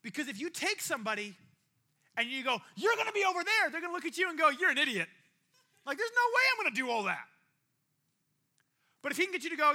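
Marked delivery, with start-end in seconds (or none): 2.8-3.7 loud, high-pitched overblown announcement; blunt mock order. Tempter bluntly telling someone where they'll end up, loud mock order. 4.5-5.1 loud dismissive retort; mock scorn. Person scoffing back at the tempter, loud mock scorn. Acting the indignant reply. 6.0-7.3 loud, high-pitched protest; indignant refusal. Acted. Person refusing the tempter outright, loud mock indignation.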